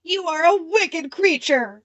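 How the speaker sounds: Dorothy lets the witch have it; crying out angrily. A narrator's reading. Dorothy crying out at the witch, indignant. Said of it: "cried Dorothy".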